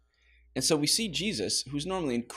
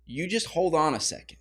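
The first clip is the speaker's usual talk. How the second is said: stern parent; 7 dB louder, slower than usual. Pretend. Parent halting a blaming teenager with a loud stern rebuke. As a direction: stop the kid right there, sharply. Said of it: "No, you're gonna say".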